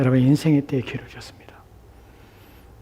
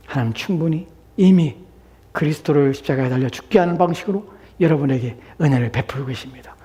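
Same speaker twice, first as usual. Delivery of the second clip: normal talking voice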